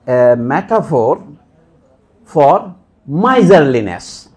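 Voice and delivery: a metaphor for miserliness spoken very slowly, word by word; deliberate statement. Shown as a demonstration. Lecturer pronouncing the answer to his own question, very slow. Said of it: "এইটা কি বলে?".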